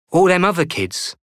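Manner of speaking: put-on a boy fretting over the others; worried boy.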